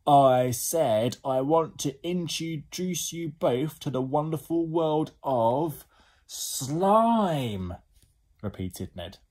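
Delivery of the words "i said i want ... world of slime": slow, high, loud announcement; louder shout. Acted. Ned calls up to Slime on the roof, louder shout. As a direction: boom it out word by word, stretching the last one. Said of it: "Shout louder".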